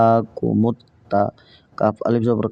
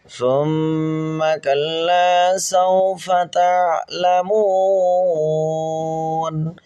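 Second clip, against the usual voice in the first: slow, high melodic chant; Quran recitation; 4 semitones higher, much slower than usual. Shown as a demonstration. Teacher recites the full verse for students in a slow high chant. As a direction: sing the verse out slowly with long held vowels.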